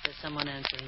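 hushed aside. Acted. Aside while phone rings.

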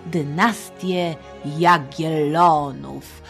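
usual speaking voice

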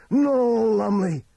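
high, loud warning shout; full-throated, high-pitched cry. Acted. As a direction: yell after someone to stop. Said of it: "Tookie cried".

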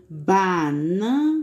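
Banana stretched slowly, each syllable clear. Shown as a demonstration. Teacher to pupils, showing how to sound out the fruit, slowly. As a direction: say it very slowly so they hear the vowels. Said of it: "Bem devagarzinho pra saber qual é a vogal".

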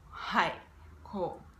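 usual speaking voice

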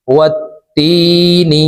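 ওয়াত্তিনি with the তা held double and drawn out slowly; doubled t. Shown as a demonstration. Teacher modelling the doubled letter for learners. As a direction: stretch ওয়াত্তিনি, pressing the doubled তা. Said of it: "তাসদিদওয়ালা হরফ দুইবার উচ্চারণ করিয়া পড়িতে হয়".

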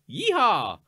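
hammed-up Western cheer; mock cowboy. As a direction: holler it like a movie cowboy. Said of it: "literally everyone's like".

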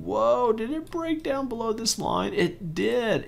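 high, startled gasp at the chart; surprised whoa. Real, not acted. Vlogger spots a stock break down, surprised and high. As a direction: pitch jumps up in real surprise.

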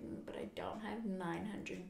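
hushed whisper to herself. Retold or imitated. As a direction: breathe it out like a private thought. Retold thought, quietly resigned.

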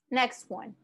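ordinary talk

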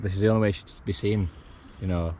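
ordinary delivery

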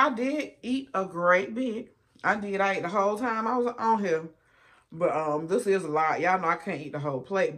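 ordinary talk, nothing marked

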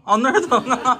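high, laughing tease. Real, not acted. Dad asks his little son where it hurts, laughing.

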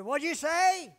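loud, high holler across a table; shouting over music. Retold or imitated. Diner can't hear father-in-law over rock music, shouting. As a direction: yell it to be heard over the din. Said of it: "It was so loud, I couldn't even hear".

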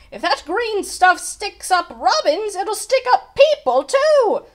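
high, loud, climbing to the end; panicky dread. A narrator's reading. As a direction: fret with mounting horror. Bell ringer frets about sticky oobleck.

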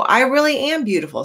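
corrected line. Acted. Reader correcting Rainbow Fish's line for the children. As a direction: read it right this time. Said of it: "Or no".

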